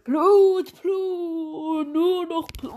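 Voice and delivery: distorted high shouting voice; voice changer. Acted. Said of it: "und zwar mit Stimmverzerrer".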